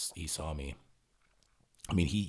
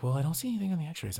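higher, quicker mock voice of the assistant; breezy higher voice of the assistant, a bit fast; imitating physician's assistant; 13 semitones higher, 6 dB louder, faster than usual. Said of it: "And she's like".

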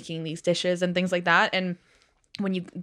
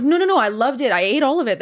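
raised, eager reassurance; flustered protest; 6 semitones higher, 5 dB louder than usual. Retold or imitated. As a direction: insist you loved it, all in a rush. Protesting to pushy hosts.

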